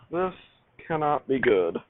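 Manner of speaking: ordinary talk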